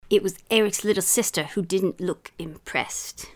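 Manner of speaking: story read in a bad British accent; hammy British accent, lively storytelling; put-on British accent. Mum reading a children's story for laughs, put-on British accent. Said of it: "throw a little accent in there".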